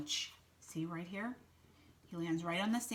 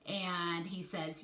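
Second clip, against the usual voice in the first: ordinary delivery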